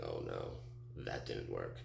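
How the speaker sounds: low, soft murmur of realization; subdued, low-pitched letdown; deflated dismay. Retold or imitated. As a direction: drop right down, quiet and let down. Dismayed after the crash.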